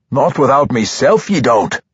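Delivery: high-pitched dwarf grumble; grumbling. A narrator's reading. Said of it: "Brunner grumbled".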